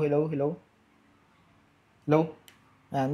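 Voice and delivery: ordinary talk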